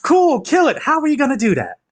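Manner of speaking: high, incredulous challenge; exasperated. Acted. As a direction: demand a plan, voice shooting up high. Berating party over their combat plan.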